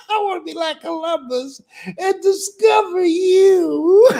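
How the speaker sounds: high squealing laugh through the words. Real, not acted. Repeats quote laughing.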